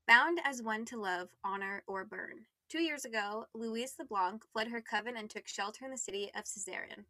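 soft, measured blurb read; book blurb reading. Acted. Reading the back page excerpt to listeners, soft and measured.

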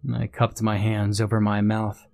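usual speaking voice

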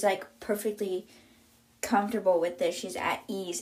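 ordinary talk, nothing marked

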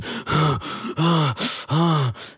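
long heavy puffing exhale; panting breath out. Retold or imitated. Boy hiding under a car, heavy puffing exhale. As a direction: blow out air after the chase. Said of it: "and I'm puffing".

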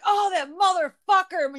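mock-annoyed cussing, pitch well up. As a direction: cuss in hammed-up annoyance. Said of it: "I'll be like".

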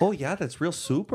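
mock North Dakota Fargo accent. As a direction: Fargo accent, cheerful and folksy. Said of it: "just a Fargo accent".

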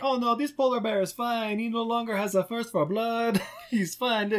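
a hint of a breezy know-it-all; mock reassuring character. Telling the movie to his wife, lightly voicing a careless character.

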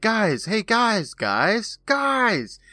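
high, pleading mock calls; calling for attention. Imitating Billy trying to alert the others, insistent high calls. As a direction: keep trying to get their attention, pitch jumping up.

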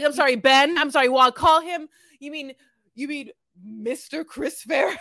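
high, loud, flustered and giddy; mock flustered gushing. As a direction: gush like a starstruck fan. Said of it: "I was like".

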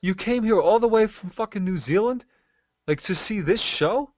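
very high, loud, disbelieving questions; retold incredulity. Amazed at a stranger's trip from New Zealand, high mock disbelief. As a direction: relive your amazement, voice shooting way up.

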